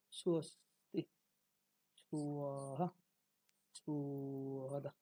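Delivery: সুঅস্তি, সুয়াহা, সুহদা each opening on a drawn-out 'suu'; Sanskrit pronunciation. Shown as a demonstration. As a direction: hold the first vowel of সুঅস্তি, সুয়াহা, সুহদা, pausing between words. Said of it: "তিনটি শব্দের সংস্কৃত উচ্চারণ কি হচ্ছে?". (সুঅস্তি, সুয়াহা, সুহদা, টেনে সংস্কৃত উচ্চারণে)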